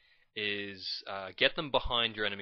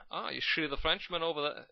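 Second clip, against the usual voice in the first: put-on higher try at a Dutch accent that turns Sean Connery-like; attempted Dutch accent; 6 semitones higher than usual. Voicing a Dutch soldier spotting the French, botched Dutch accent. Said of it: "how's the Dutch accent go".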